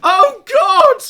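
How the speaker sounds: screaming in mock horror; hammed-up terrified shouting; panicked screaming. Acted panicked audience member.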